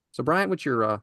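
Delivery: ordinary talk, nothing marked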